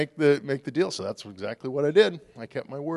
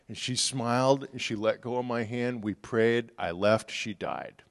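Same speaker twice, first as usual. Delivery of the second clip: normal talking voice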